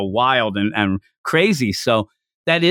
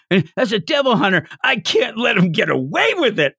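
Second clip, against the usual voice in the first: high-pitched theatrical indignation; fired-up mock resolve; 8 semitones higher than usual. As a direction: vow justice in a put-on heroic fit. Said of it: "he is so there".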